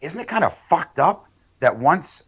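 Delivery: rising outraged disbelief, pitched high; worked up and incredulous; indignant rant. Real, not acted. Ranting to friends about the cabinet, outraged.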